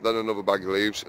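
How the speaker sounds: normal talking voice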